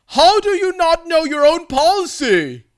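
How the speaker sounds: high, loud indignant demand; indignant old man. Retold or imitated. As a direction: snap at her like a cranky old man. Old guy demands answer.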